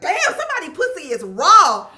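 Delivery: loud, high mock callout; rude senior girl. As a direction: imitate a rude girl announcing it to everyone. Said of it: "she was so fucking rude".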